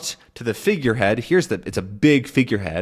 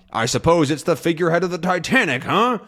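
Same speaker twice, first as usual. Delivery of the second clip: higher, fast sarcastic jab; mocking repeat; 6 semitones higher, faster than usual. Teacher replaying Haddock's dig at Calculus, mocking. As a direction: rub it in with a sneer. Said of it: "he's trying to prove a point".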